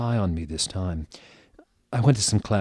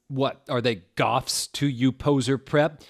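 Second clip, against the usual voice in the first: higher, louder mock sneer; angry retort; 6 semitones higher, 3 dB louder than usual. A narrator's reading. Narrator snarling at Hagrid over pink roses, mock angry. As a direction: jab at him with a pitched-up sneer. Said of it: "because I was angry".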